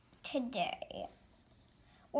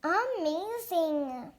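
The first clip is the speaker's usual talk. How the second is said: ordinary delivery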